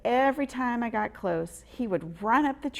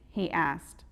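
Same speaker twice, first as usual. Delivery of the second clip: usual speaking voice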